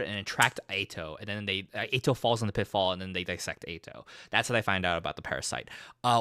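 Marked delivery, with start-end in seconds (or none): none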